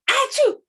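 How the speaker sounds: high, loud hammed-up achoo; loud fake sneeze. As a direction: burst out a big pretend sneeze. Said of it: "I just keep sneezing".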